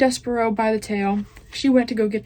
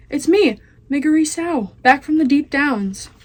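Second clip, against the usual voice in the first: higher, louder slow shout; 6 semitones higher, 5 dB louder than usual. Acted. Meg shouts to Cook on returning, loud slow shout. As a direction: call it out across the kitchen. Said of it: "she shouted".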